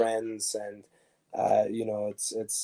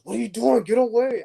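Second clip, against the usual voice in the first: high, loud mock scold; startled shoo; 12 semitones higher, 8 dB louder than usual. Teammate shooing him from the bench seat, high and loud.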